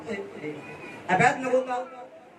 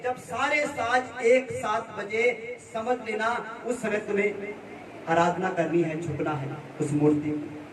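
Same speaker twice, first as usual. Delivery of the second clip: a king's command, slowed and sinking lower; 3 semitones lower than usual. Acted. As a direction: draw out the order, dropping lower toward the end. King's decree acted out in sermon.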